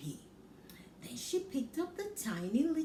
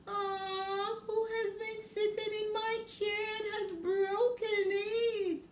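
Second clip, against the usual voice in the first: much higher, sing-song weepy cub voice; tiny whining Baby Bear; 10 semitones higher than usual. Acted. Baby Bear laments broken chair, high whimpering voice. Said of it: "he asked in a tiny little voice".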